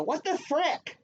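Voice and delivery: high, squeaky schoolkid asking; a kid whispering a near-swear on the slide; mock kid voice. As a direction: pipe it up like a fourth grader. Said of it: "I heard someone go".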